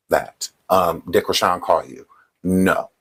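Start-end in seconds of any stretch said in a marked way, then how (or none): none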